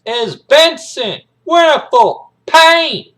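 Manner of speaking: hard-core Marine bellowing his introduction; high, loud, slow drill-sergeant shout; Major Payne. Acted. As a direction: announce yourself slowly at full parade-ground volume. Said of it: "he's introducing himself".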